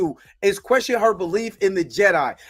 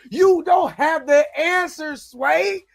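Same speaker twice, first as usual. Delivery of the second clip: slow, raised, louder mock [name] rant; a light [name] impression, louder and drawn out; imitating [name]; 6 semitones higher, 3 dB louder, slower than usual. Vlogger channels [name] at Osha, slow and loud. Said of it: "I was looking for [name] to come out and be like".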